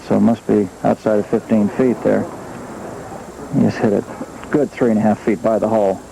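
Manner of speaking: normal talking voice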